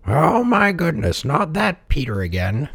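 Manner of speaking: put-on high, slow old villager; high-voiced old man's lament. A narrator's reading. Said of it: "an old man heard the shouting".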